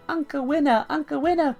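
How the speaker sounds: high, loud mock child calling out; imitated child's shout. Old uncle imitates the small child, high hammed-up shout. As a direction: mimic a little one shouting for uncle.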